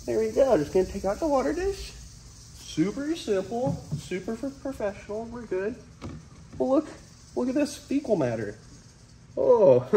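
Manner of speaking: excited high voice. Real, not acted. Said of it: "We're calm".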